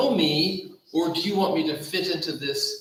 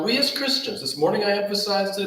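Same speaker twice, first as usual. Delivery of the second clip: ordinary delivery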